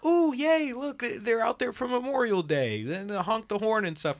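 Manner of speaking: high, loud, gushing mock-wife voice; wife's gushing delight. Husband imitating his cheerful wife spotting flags, high gushing voice.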